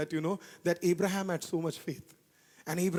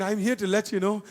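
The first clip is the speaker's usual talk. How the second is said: ordinary delivery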